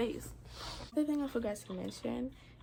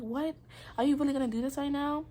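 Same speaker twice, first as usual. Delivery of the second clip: higher, louder, fast disbelieving question; incredulous protest; 6 semitones higher, 4 dB louder than usual. Retold or imitated. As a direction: throw it at him in indignant disbelief. Retelling her protest at him.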